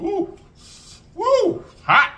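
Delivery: high yelp at the heat; high, loud woo from spice; hot sauce shock. Real, not acted. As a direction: squeal from the burn. Reacting to spicy hot sauce.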